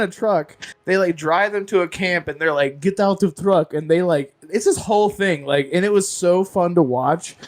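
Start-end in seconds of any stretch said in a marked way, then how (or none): 2.8-3.7 slightly higher Russian guard's command; mock Russian accent. Russian guard emptying a truck, mock Russian accent. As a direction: bark it like a pretend Russian soldier. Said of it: "these Russian players were like".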